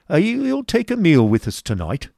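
a bluff host laying down orders; Squeers' voice. A narrator's reading. Schoolmaster Squeers to new teacher, bluff and loud. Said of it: "said Squeers".

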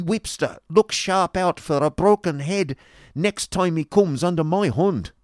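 loud, high-pitched Yorkshire rant; blustering table-thumper's outburst; angry Yorkshireman. Acted. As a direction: threaten them in broad Yorkshire, at full volume. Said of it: "said the Yorkshireman, striking the table heavily".

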